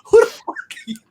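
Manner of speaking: high, laughing disbelief that breaks into a shout; mock outrage at being asked for an autograph; incredulous. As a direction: act floored that anyone would ask. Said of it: "I'm like".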